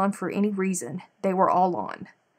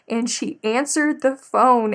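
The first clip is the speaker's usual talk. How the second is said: ordinary talk, nothing marked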